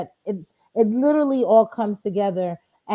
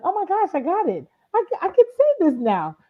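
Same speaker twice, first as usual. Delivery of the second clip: thrilled, much higher and quicker; excited; 9 semitones higher than usual. Retold or imitated. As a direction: burst out with joy at finally getting it. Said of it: "I'm like".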